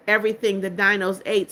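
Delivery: ordinary delivery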